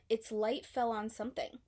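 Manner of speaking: usual speaking voice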